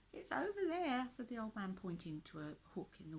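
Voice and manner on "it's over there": put-on high, loud, creaky old villager voice; croaky old man. A narrator's reading. Old villager pleads with the knight to free them, croaky. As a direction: croak it out like a frail old prisoner. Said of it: "in a very croaky voice".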